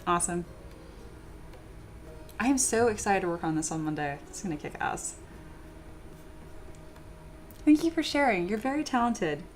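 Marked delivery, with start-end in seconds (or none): none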